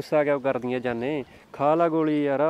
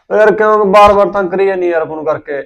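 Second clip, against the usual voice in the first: louder, higher exasperated whine into the phone; irritated complaint; 5 semitones higher, 12 dB louder than usual. Acted. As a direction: let her hear how sick of it you are. (Phone ਤੇ ਖਿਝ ਕੇ ਉੱਚੀ ਸ਼ਿਕਾਇਤ)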